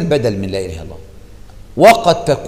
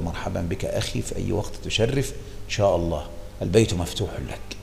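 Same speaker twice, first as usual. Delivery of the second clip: softly retold welcome, a touch of warmth; welcoming reply; 5 semitones lower, 9 dB quieter than usual. Sheikh quoting his soft welcome to a hopeful student. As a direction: gently quote your kind invitation.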